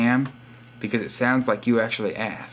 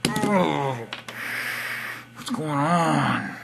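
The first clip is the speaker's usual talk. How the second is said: higher, drawn-out wail of confusion; exasperated whine; 4 semitones higher, much slower than usual. Pretend. Vlogger baffled by YouTube's missing video, exasperated whine. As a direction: whine it slowly, stretching the last word. Video will not play.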